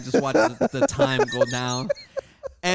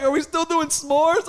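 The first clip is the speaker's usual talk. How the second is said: high, loud, slow imitation; hopeful youngster; 13 semitones higher, 6 dB louder, slower than usual. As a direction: ask innocently for the promised treat. Imitating Julian's hopeful question.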